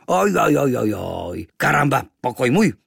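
loud wail sliding down, then emphatic; disapproving native. A narrator's reading. Native objects to boy in his room, loudly disapproving. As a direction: lament the invaded room. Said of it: "Domorodec nesouhlasně vrtěl hlavou".

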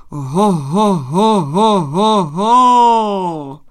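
loud, wavering horn-like "ho" calls, last one held long; terrible booming holler. A narrator's reading. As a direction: holler like a hunting horn's final long call. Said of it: "A ještě jedenkrát".

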